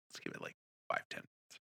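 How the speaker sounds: hushed whisper to a friend. Retold or imitated. Whispering to Dave in the packed theater. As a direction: breathe it under the noise.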